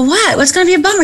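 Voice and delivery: high, pressing what; startled question. Retold or imitated. Actress pressing friend Bill to explain, high and alarmed. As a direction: push him to spit it out. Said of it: "I go".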